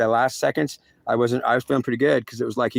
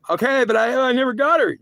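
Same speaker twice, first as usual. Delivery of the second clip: own pleading reply; 11 semitones higher than usual. Pretend. Himself protesting to God that he never got her, high and pleading.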